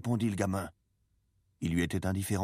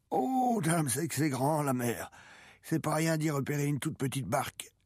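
put-on old man's voice, pitched up in wonder; musing old fisherman; 9 semitones higher than usual. A narrator's reading. Old man explaining the failed search to the boy, musing.

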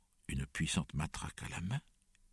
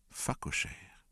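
ordinary delivery

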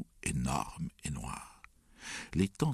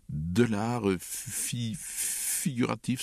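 slow, stuttering sobbed answer; high, halting tearful voice; sobbing; 6 semitones higher, much slower than usual. A narrator's reading. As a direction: break the word up with sobs. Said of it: "sanglota Alexandre".

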